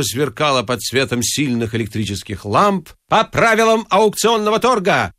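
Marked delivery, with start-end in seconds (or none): 3.1-5.1 high, carrying announcement; ringing declaration. A narrator's reading. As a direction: proclaim the rule to the hall. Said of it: "звонко заявил он".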